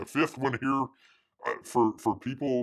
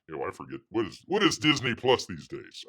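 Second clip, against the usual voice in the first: ordinary delivery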